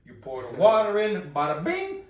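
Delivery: loud Jersey Italian host explaining; brash Italian Jersey accent; fake Italian accent. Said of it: "all I do is a fake Italian accents".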